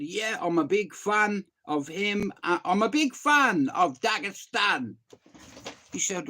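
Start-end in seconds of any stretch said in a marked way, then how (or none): 0.0-5.0 high, loud, gushing declaration; retold burst of big enthusiasm; enthusiastic fan. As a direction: belt it out like a proud fan. Acting out his own enthusiastic reply.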